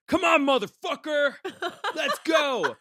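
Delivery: high, loud mocking yell of a furious woman; imitating an angry driver yelling from the street; furious street challenge. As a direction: bellow it like she's squaring up. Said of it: "she goes".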